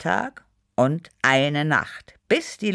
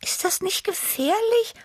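high, breathy near-whisper of awe; breathed. A narrator's reading. Tibi asks Chili about danger, breathed out. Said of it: "hauchte Tibi".

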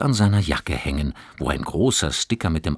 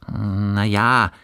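embarrassed; 4 semitones higher, 5 dB louder than usual. A narrator's reading. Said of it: "sagte Johannes sichtlich verlegen".

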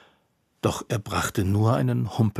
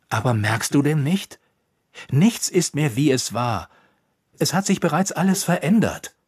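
urgent confrontation; 5 semitones higher, 4 dB louder than usual. A narrator's reading. Usinjol confronts Ropucha.

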